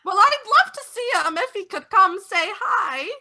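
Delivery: put-on high, sweet old neighbour; pry politely like a kindly old neighbour; old neighbour quizzing the vampires, high and sweet; old lady voice.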